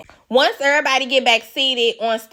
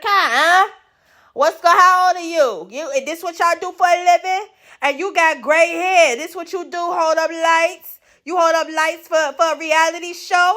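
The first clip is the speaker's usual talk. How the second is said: higher, put-on voice scolding the lighting crew; mock Tommy scolding; 6 semitones higher than usual. Tommy mocking the crew for holding lights, lightly voiced scorn. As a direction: sneer at the gray-haired light man.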